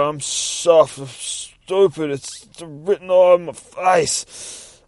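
hammed-up pitiful shark goon; pleading shark thug. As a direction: beg like a sorry thug who got played. Guppy's pleading confession.

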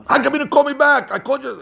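high, indignant demand; hammed-up boss who expects instant callbacks; impatient boss.

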